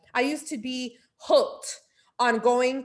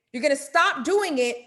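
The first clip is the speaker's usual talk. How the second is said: ordinary talk, nothing marked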